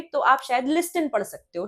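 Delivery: ordinary talk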